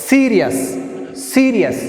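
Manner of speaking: "Serious" with a high, forceful SE, then a fall; teacher modelling "Serious", stressed at the start; first-syllable stress. Shown as a demonstration. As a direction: push SE hard, drop the rest of "Serious". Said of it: "First syllable is pronouncing".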